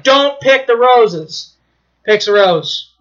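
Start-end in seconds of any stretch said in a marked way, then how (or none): 0.0-1.5 drawn out slowly, each word weighted; slow sign reading. Retold or imitated. Storyteller reads the warning sign slowly. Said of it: "There's a big sign".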